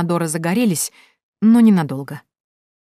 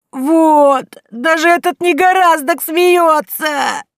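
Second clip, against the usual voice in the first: high, loud, slow keening wail; 11 semitones higher, 7 dB louder, much slower than usual. A narrator's reading. Girl wailing at the boy, high and slow. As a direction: wail it like a village lament. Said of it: "заголосила Минадора".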